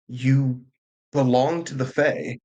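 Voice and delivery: ordinary talk, nothing marked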